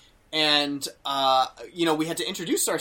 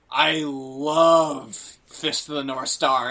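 imitating delighted actor; 4 dB louder, much slower than usual. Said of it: "And he goes".

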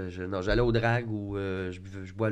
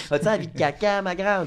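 mocking childish voice; 6 semitones higher than usual. Mocking the texter's childish phrasing.

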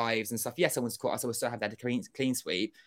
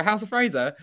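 incredulous; 9 semitones higher, 6 dB louder than usual. Retold or imitated. Incredulous echo of instruction.

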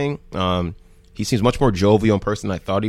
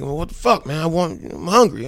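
higher-pitched mock complaint; exasperated, put-on whine; upset whining; 7 semitones higher than usual. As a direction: imitate a hungry man fed up with waiting. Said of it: "like, really upset".

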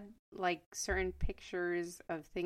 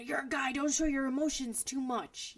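mock macho voice; 6 semitones higher than usual. Mocking macho advice to boys.

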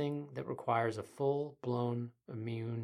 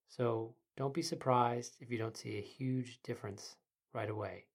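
soft, quiet meditation guide; sleep story narrator; 5 dB quieter than usual. Acted. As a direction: ease the listener into calm.

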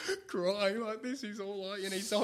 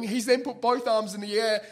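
usual speaking voice

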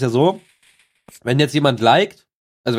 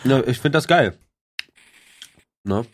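ordinary talk, nothing marked

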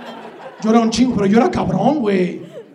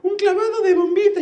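put-on little kid's voice; 10 semitones higher than usual. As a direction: squeal it like an excited little kid at the pool. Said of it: "empecé a oír una vocecita".

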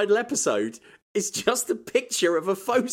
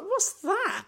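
baffled high squeak. Retold or imitated. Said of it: "I was like".

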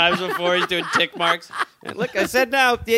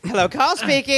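higher, put-on phone greeting; mock lady's voice; 7 semitones higher than usual. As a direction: demonstrate a silly lady on the phone. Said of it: "I'm gonna need a lady's voice".

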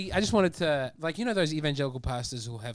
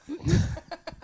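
high laugh; 14 semitones higher than usual. Real, not acted. Laughing at the bad joke.

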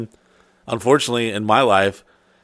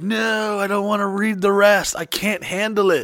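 mock overwhelmed complaint; 9 semitones higher than usual. Overloaded host refusing to read the rest, mock overwhelmed. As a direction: plead out in an exaggerated high voice.

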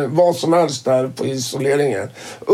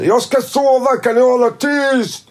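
calling out; 9 semitones higher than usual. Retold or imitated. Så ropar han ut då till alla.

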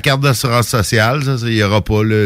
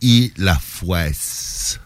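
put-on slow, low suave Portuguese accent; smooth, drawn-out mock-suave Portuguese accent; 4 semitones lower, slower than usual. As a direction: draw out the wine name in a suave Portuguese accent. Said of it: "avec un accent suave".

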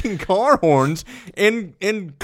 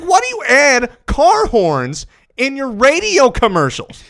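exasperated; 6 semitones higher, 6 dB louder than usual. Retold or imitated. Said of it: "I'm like".

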